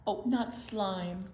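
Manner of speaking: normal talking voice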